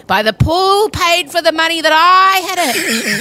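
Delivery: high, drawn-out parental grievance, hammed up; indignant rant. Mother fuming at daughters lounging by the pool, indignant. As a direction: stretch the outrage and lean hard on I.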